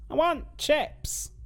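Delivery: high, slow squeaky whine; drawn-out comic whine; silly high voice. Pretend. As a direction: moan for chips like a cartoon. Silly chips voice again.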